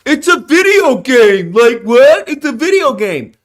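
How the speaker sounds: slow, sneering mock of a dismissive gamer; mocking dismissive quote. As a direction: parody the people who brush games off. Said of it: "Because people are like".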